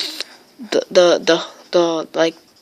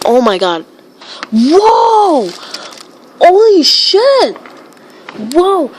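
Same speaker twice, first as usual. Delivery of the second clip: high, loud screams of oh my god; shocked screaming; 9 semitones higher, 5 dB louder, slower than usual. Real, not acted. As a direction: scream in panic as the storm hits. Storm wrecking the house live.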